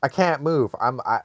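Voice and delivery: normal talking voice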